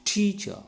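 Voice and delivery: "teacher" with TEA hit high, cha dropping; first-syllable stress. Shown as a demonstration. Teacher demonstrates the rule, "teacher" stressed on TEA. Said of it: "applying the stress on first syllable".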